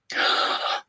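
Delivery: a sudden breathless gasp of shock; sharp gasp. Real, not acted. Streamer reading chat, sharp surprised gasp. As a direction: gasp as the news lands.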